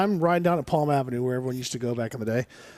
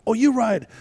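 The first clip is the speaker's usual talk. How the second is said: higher-pitched mimicked Portuguese accent; 7 semitones higher, 4 dB louder than usual. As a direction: lightly imitate a Portuguese man's accent. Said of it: "speaking in this hard Portuguese accent".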